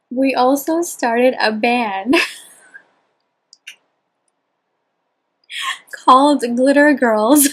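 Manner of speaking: reading through laughter; giggling while reading aloud; laughing. Real, not acted. Reading diary, bursting into laughter.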